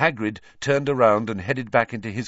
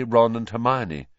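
plain reading voice

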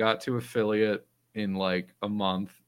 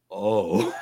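startled "Oh" breaking into a laugh; retold dawning shock; sudden realization; 3 dB louder than usual. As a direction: catch it mid-realization. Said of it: "and she goes".

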